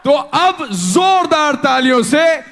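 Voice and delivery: high, loud, slow emcee call; stage announcer. Real, not acted. Host welcoming the director on stage, announcer shout. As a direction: bring him on with a roar.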